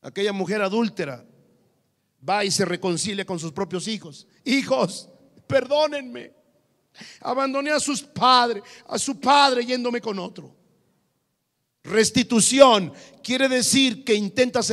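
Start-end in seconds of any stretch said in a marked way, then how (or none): 4.4-10.5 acted pleading mother. Adulterous mother voiced to children.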